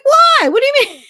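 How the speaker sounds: mock-shocked, high squeal; shocked disbelief. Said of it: "I was like".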